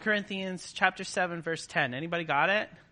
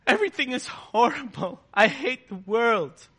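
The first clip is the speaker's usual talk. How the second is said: mock complainer; 4 semitones higher than usual. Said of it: "Mourning is not".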